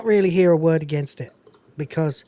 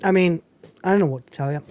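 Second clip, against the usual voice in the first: ordinary talk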